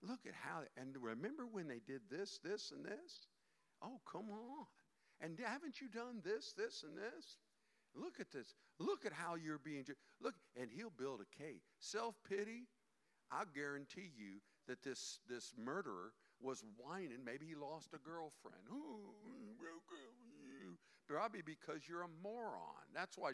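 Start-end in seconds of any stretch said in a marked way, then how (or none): 0.0-10.3 soft, sly coaxing tempter; the devil coddling you, soft and sly. Acted. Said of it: "He loves to coddle you and saying". 18.7-20.8 soft, slow mock sympathy; mocking a whining murderer, soft and slow; mock whine.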